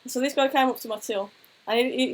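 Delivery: ordinary talk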